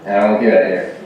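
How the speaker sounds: ordinary talk, nothing marked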